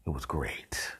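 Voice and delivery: breathy whispered gloat, no voice; whispered aside. Real, not acted. Narrator whispering to the audience about the flies' fate. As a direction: whisper it like a guilty pleasure.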